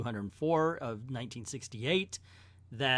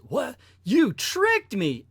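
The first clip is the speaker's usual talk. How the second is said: shocked outrage; 9 semitones higher, 8 dB louder, slower than usual. Acted. Superman realizes he was tricked.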